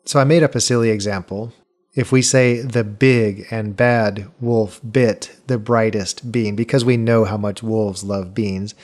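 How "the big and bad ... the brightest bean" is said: slow, with weight on "big", "bad", "bit", "brightest" and "bean"; stress on the alliterating words. Shown as a demonstration. Host's silly wolf example, slow with the B words stressed. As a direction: punch each B word, slowly. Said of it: "notice how big, bad, bit, bright, and bean all were the accented words".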